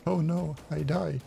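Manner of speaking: hammy dying actor; overacted death scene. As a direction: melodramatic slow-motion death. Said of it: "over-exaggerating way too much".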